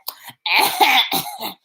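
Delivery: loud exaggerated choking hack; sudden hammed-up choke; mock choking. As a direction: imitate someone choking on overheard words. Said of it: "like, she choking on our words".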